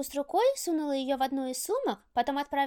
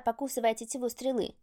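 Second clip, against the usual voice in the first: usual speaking voice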